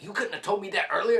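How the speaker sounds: fast, high, loud mock complaint; imitating shocked rider. Stranger scolding the dad too late, fast and high. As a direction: blurt the complaint in a hurry. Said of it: "He's like".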